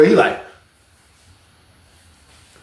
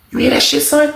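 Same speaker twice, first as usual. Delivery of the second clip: alarmed question; 11 semitones higher than usual. Retold or imitated. Roommate alarmed.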